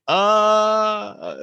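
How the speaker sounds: long, high held "uh"; awkward hesitation. Retold or imitated. Onlookers watching the abbot's mistake, mock awkward. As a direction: imitate an uncomfortable onlooker stalling on one drawn-out note. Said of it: "had the look on their face like".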